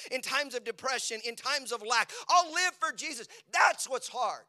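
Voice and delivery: loud, high preaching, slowing to a crawl; full-force preaching. Acted. Pastor preaching to the congregation at full force. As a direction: preach it out with power.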